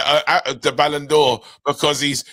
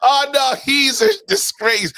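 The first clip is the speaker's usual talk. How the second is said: mock outrage; 11 semitones higher, 4 dB louder than usual.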